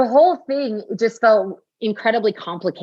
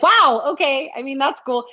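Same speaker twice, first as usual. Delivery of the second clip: a fake-cool shrug in the voice; mock impressed; 7 semitones higher than usual. Retelling her reaction to another pregnancy.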